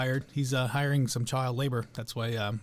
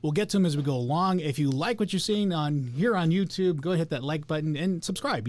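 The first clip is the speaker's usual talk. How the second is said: normal talking voice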